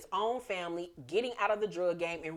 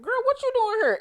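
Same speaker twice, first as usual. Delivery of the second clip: much higher and louder, thrilled surprise; surprised greeting; 8 dB louder than usual. Retold or imitated. Tasha opening the door to Tamika, a much higher, louder delighted squeal. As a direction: squeal it as you open the door.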